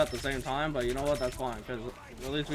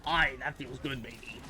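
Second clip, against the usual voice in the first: pirate voice; 3 semitones lower, 6 dB quieter than usual. Retold or imitated. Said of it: "talk like a pirate".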